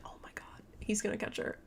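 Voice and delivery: hushed, tense whisper of suspense; breathless whisper. Retold or imitated. Listener gasps at a chase scene, whispered. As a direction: whisper it like you're scared he'll hear. Retelling her own tense reaction.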